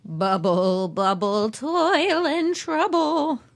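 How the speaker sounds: slow drawn-out witch chant; old hag. Acted. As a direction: cast it like a cackling hag. Witch incantation, Shakespeare.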